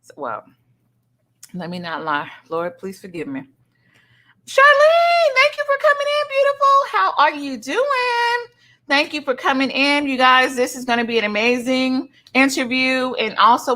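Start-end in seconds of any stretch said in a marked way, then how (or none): 4.5-8.5 excited welcome. Real, not acted. Streamer sing-songing a welcome to Charlene. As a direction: sing her name out in delight.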